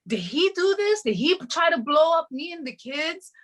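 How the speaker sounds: high, alarmed suspicion; suspicious alarm. Retold or imitated. Recalling wondering whether her ex caused the fire, alarmed. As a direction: ask it as if fearing the worst.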